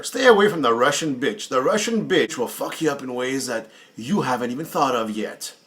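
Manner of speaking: ordinary talk, nothing marked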